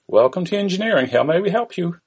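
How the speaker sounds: put-on Indian-accented call centre greeting. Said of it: "An Indian voice is heard".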